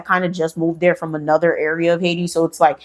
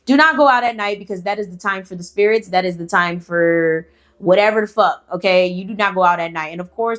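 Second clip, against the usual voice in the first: usual speaking voice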